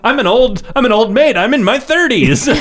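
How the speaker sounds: hammed-up lament, pitch jumping up; acted film character. Host mocks a woman lamenting her age, high hammed-up protest. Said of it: "where she's like".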